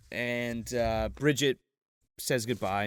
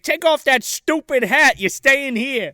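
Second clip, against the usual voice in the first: loud, high, slow put-on order; bossy dad; 9 semitones higher, 6 dB louder, slower than usual. Dad tells grandma she is staying, loud bossy mock voice. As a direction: insist forcefully in a hammed-up voice.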